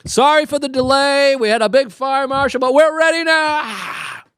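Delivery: high put-on host voice, apologetic; nervous event host. Organizer apologizing for delay to the crowd, high put-on voice. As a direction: sell a late show to an impatient crowd. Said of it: "the guy running it goes up".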